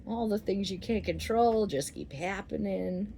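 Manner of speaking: usual speaking voice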